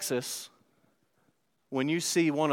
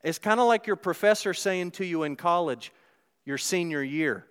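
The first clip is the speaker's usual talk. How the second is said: ordinary delivery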